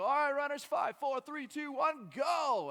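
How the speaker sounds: loud, high announcer countdown; race announcer. Retold or imitated. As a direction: call the start over a crowd. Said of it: "the announcer go".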